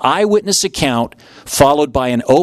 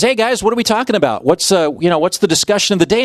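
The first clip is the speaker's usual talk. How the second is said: higher, fast, breezy greeting; casual Jesus; 5 semitones higher, faster than usual. Retold or imitated. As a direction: toss it off like a buddy. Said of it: "he says".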